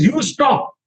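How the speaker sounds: high-pitched retort, acted; throwing it back. Krishna looks back at the gopi, high-pitched retort.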